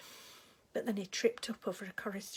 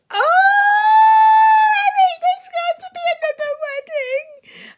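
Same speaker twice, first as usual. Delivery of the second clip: very high, very loud excited shriek; loud hammed-up squeal of joy; mock shriek; 25 dB louder than usual. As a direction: scream the news with delight. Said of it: "She shrieked so loud".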